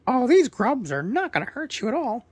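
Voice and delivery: high, loud squawky parrot; proud parrot introducing himself, high and loud. Acted. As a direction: chirp it out as a cheerful parrot bird. Parrot reassures the boys.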